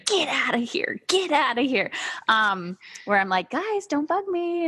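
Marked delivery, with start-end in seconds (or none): 0.1-1.9 whispery, higher-pitched shooing; hushed shooing. Retold or imitated. Shooing kids trying to sneak in. 3.5-4.7 high, drawn-out mock plea; mock pleading. Retold plea to her kids.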